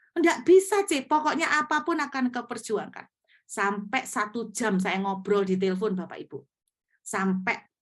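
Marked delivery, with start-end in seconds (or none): 0.1-3.1 high, loud mock protest; hammed-up defiant student; imitating a defiant refusal, higher and louder; stubborn refusal. Student refuses, arguing on phone.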